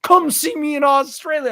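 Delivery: high-pitched shouted challenge; imitated aggressive outburst. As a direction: imitate someone jumping up to call out a rival. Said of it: "right out of his seat, just like".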